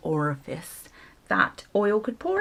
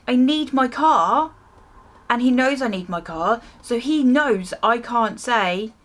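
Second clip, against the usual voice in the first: higher, louder, slowed outraged rant; indignant rant; 5 semitones higher, 3 dB louder than usual. Real, not acted. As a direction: spell out each grievance, fuming. Ranting about the bill.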